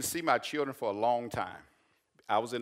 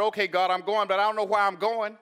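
higher, louder pleading cry to God; raised plea to God; 10 semitones higher, 8 dB louder than usual. Retold or imitated. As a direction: call out to God, half protesting.

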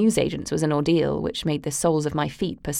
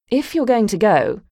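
higher, louder crisp dismissal; cold dismissal; 5 semitones higher, 5 dB louder than usual. Acted. Mother coolly telling her daughter to hurry off. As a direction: brush her off impatiently.

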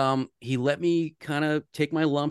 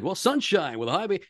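higher, put-on radio weather read; on-air announcer read; 6 semitones higher than usual. Young broadcaster auditioning a weather forecast for his boss, on-air announcer voice. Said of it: "read that like you're on the air".

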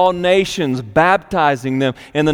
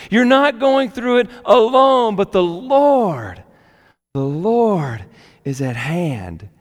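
preaching; 4 semitones higher than usual. Acted. Preaching rise to reassurance.

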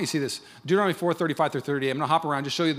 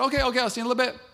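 chipper reply; 9 semitones higher, much faster than usual. Retold or imitated. His cheerful reply retold.

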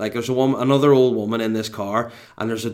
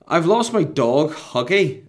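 lightly voiced old woman, higher and slower; distressed old woman; 6 semitones higher, 4 dB louder, slower than usual. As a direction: imitate a worried woman who lost her dog. Old woman in peril, retold.

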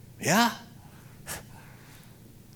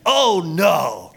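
loud, high mock cry of alarm; imitated shout of dismay; 7 semitones higher, 12 dB louder than usual. The church lady seeing the pastor arrive, mock alarm. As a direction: throw out her startled shout. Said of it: "She goes".

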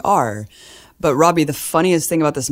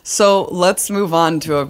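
louder, higher, holding back real laughter; trying not to laugh; 5 semitones higher, 4 dB louder than usual. Real, not acted. Host moving on to sober news, trying not to laugh. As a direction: push on while suppressing a laugh. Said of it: "I'm trying not to laugh".

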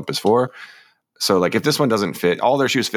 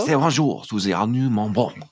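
slow, thickly put-on French accent; terrible French accent; 3 semitones higher than usual. Co-host repeating shoe ad on purpose, hammed-up French accent. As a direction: ham up the bad French accent, drawn out. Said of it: "I said that in an accent".

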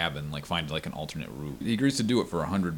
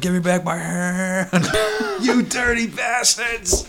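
high, slow, hammed-up yell; mock angry shout; 13 semitones higher, 7 dB louder, slower than usual. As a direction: shout it like a furious hand-grabber. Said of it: "it's like".

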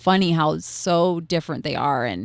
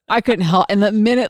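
usual speaking voice

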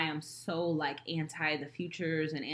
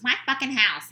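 higher, louder mock-mother retort; raised-voice parental comeback, acted; retold snapping mother; 7 semitones higher, 7 dB louder than usual. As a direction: imitate mom shutting down the argument. Retold argument with mom.

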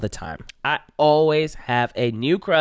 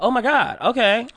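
acted shock; 9 semitones higher than usual. Acts out reaction to mom's answer.

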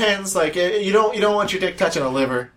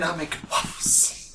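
ordinary talk

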